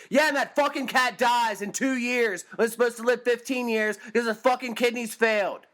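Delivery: real exasperated tirade; angry rant. Real, not acted. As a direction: vent the fury at them. Rant against vegan cat owners.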